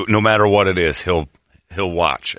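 ordinary talk

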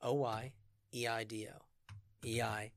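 slow, letter by letter spelling. Real, not acted. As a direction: spell each letter out slowly. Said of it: "Can you spell it for us?".

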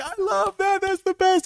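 very high, squeaky gushing; mock delight. Everybody at the table loving it, delighted.

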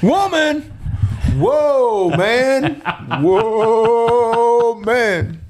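high, held-out chant of each word; hammed-up poetic wailing; drawn-out movie quote. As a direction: stretch the words out, high and theatrical.

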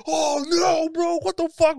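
high shouted imitation of a crushed buddy; mock dismayed friend. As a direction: wail it like a hammed-up heartbroken guy. Voicing Alex's dismay.

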